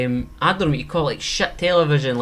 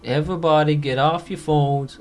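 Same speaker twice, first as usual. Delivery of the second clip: ordinary talk, nothing marked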